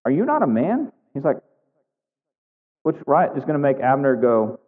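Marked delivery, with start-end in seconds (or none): 0.0-0.9 taunting question, swooping high; high, teasing dare; calling to account. Retold or imitated. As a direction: needle him with a mock-incredulous challenge. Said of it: "he's calling him to account. He says".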